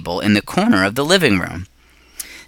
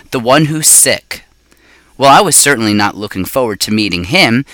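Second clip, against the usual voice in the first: ordinary delivery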